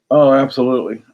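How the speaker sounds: ordinary talk, nothing marked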